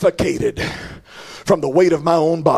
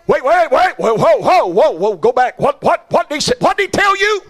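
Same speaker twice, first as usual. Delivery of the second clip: much higher, louder, rapid-fire astonished questions; excited crowd clamor; 9 semitones higher, 3 dB louder than usual. Acted. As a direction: jump in as a stunned, eager crowd. Paradise crowd clamors at news.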